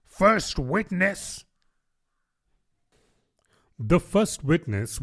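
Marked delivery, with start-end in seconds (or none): 0.0-1.4 slow, higher herald call; the rabbit's mild mock call. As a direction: announce it to the court. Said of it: "called out".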